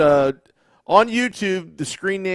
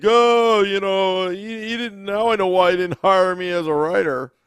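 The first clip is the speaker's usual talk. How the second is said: mocking commenter; 6 semitones higher, 4 dB louder than usual. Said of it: "one guy was like".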